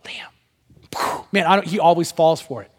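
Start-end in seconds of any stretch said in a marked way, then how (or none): 0.0-1.2 soft whispered name; hushed, breath-only calling. Shown as a demonstration. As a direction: whisper the child's name to catch attention. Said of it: "But if I whisper".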